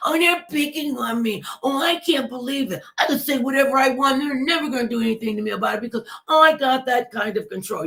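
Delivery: high, whining mock complaint, fast at the end; whiny voice. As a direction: whine it like a spoiled child, then rush the boast. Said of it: "I'm trying to get the whiny voice".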